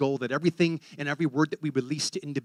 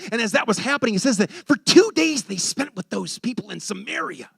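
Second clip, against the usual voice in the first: rising, louder sermon voice; fervent preaching; 5 semitones higher, 8 dB louder than usual. Acted. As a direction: preach it out to the congregation. Preaching at full force.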